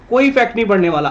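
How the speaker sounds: कोई इफेक्ट नहीं पड़ने वाला rattled off fast at normal pitch; fast plain repeat; quick follow-up. Real, not acted. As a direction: breeze through it, point made. Teacher repeats point quickly.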